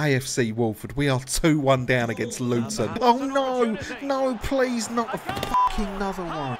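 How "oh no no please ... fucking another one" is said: high, despairing plea; anguished gamer protest; dismayed pleading. Real, not acted. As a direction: beg as another goal looms. Conceding another goal.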